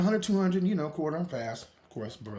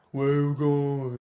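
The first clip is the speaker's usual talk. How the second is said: stern grandpa; 6 semitones lower than usual. Retold or imitated. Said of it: "he's like".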